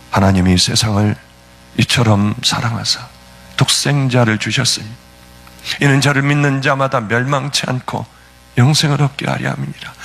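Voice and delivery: low, measured recitation of a Bible verse; deep reverent scripture reading; solemn scripture recitation. Acted. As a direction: intone the verse as God's own words.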